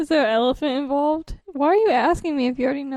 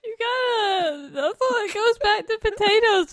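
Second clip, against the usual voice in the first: laughing; 7 semitones higher than usual. Real, not acted. Laughing through a silly answer.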